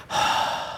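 soft voiceless sigh; a tired sigh before speaking; a frail friend's sigh; imitating a weak sigh. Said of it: "He goes".

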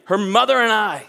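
loud, strong declaration; with strength. Shown as a demonstration. Dad answering at the wedding rehearsal, strong and loud. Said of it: "answer with strength".

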